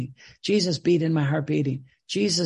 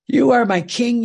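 lifted higher and louder in praise; fervent proclamation; 5 semitones higher, 7 dB louder than usual. Real, not acted. Preacher praying to Jesus, loud fervent proclamation. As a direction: proclaim it to your King with full fervor.

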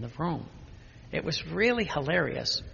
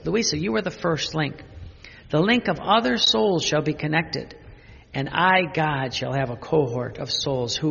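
rising, louder proclamation of the divine words; solemn reading; 3 semitones higher, 5 dB louder than usual. Acted. Preacher reading Jesus' words to Louisa, building louder. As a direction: proclaim it with growing force.

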